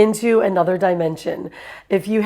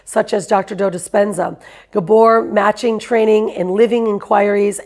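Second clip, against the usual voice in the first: ordinary talk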